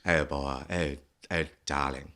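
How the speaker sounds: low, camp 1940s posh accent; nineteen forties posh. Shown as a demonstration. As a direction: slip into old-film posh. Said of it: "like, this weird, like, nineteen forties".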